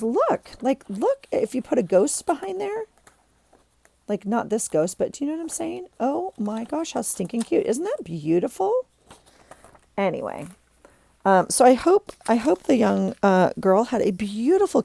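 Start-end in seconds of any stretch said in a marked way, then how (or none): 0.0-8.9 gushing. Real, not acted. Gushing over a ghost idea.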